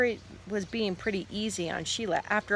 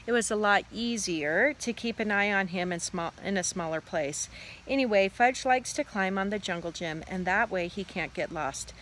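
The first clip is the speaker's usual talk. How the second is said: ordinary delivery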